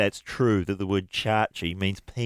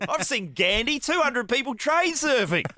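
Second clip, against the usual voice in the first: much higher, slowed excited claim; excited exaggeration; 13 semitones higher than usual. Real, not acted. Host mocking the railway claim, high and gleeful. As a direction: pile it on with glee.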